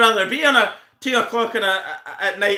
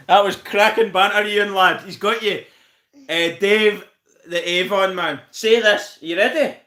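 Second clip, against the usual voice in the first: ordinary talk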